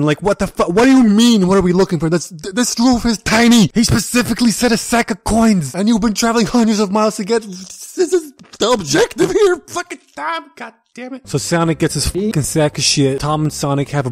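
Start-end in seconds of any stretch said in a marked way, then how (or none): 0.2-11.3 exasperated rant. Real, not acted. Reviewer fuming at Tom's dumb line, high and exasperated. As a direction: whine in disbelief at the script. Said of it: "this line makes me super mad".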